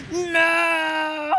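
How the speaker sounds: high loud cry of no; anguished shout. Real, not acted. Gamer watching himself fall, anguished shout. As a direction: wail it out. Dying in game.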